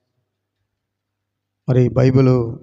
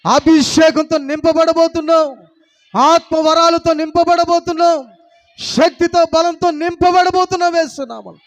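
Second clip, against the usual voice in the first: preaching at full force; 10 semitones higher than usual. Acted. Pastor proclaims miracles over the congregation, building fervent preaching. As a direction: declare each blessing like a rising shout of faith.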